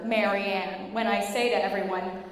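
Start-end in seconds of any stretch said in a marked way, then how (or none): none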